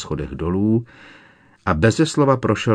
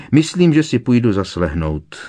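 higher, louder curt announcement; 5 semitones higher, 5 dB louder than usual. A narrator's reading. Snape breaks off the argument to retire, curt. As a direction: cut the talk short. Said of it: "řekl Snape úsečně".

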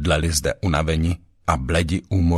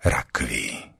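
hissed; 6 semitones lower than usual. A narrator's reading. Ratcatcher hisses a deathly threat at Froš, hissed. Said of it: "zasyčel krysař".

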